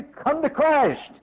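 high, slow preacher's call; urgent exhortation. Real, not acted. Preacher exhorting listeners to come.

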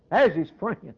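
firm, full-voiced pulpit declaration; emphatic preaching. Real, not acted. Preacher pointing to the poor man's friend, emphatic. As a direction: declare it to the congregation. Climax, then hushed repeat.

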